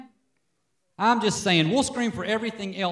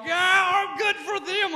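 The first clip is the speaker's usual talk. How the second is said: gleeful inner voice; 13 semitones higher, slower than usual. Retold or imitated. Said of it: "I'm like".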